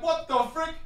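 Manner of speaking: confused onlookers finding the magician gone; high, hushed what-the-frick; baffled audience. Retold or imitated. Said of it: "they're like".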